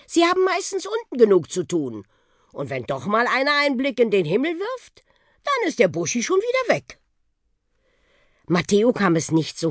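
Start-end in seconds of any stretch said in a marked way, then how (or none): 0.1-7.0 put-on high, animated old bus character; lively, self-satisfied bus voice; boastful old bus. A narrator's reading. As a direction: explain airily and proudly, pitch riding high.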